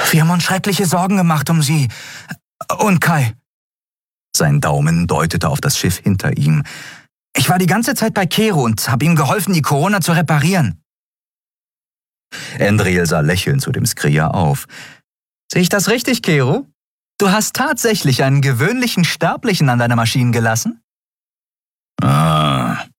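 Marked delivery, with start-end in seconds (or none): 0.1-3.3 put-on high, quick boyish voice; eager youngster, much higher; worried young boy. 7.4-10.8 put-on high, excited boyish voice; bubbly young helper, pitched up; eager young boy. 15.5-20.8 high character voice, mock disbelief; incredulous captain, much higher; teasing ship's captain. 22.0-22.9 lightly growled grunt; drawn-out, dismissive grumble. A narrator's reading. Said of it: "knurrte der Skria".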